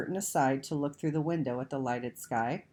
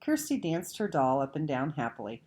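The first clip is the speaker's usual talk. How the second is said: plain reading voice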